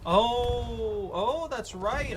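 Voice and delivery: high, slow drawn-out oh; dawning surprise, stretched; realization. Real, not acted.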